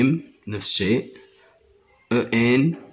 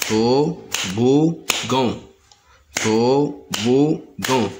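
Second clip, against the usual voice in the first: toboggan drawn out slowly, each syllable apart; slow syllable demo; much slower than usual. Shown as a demonstration. Teacher sounding out toboggan for pupils. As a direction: stretch toboggan to-bo-ggan very slowly. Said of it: "Le mot toboggan se compose de trois syllabes".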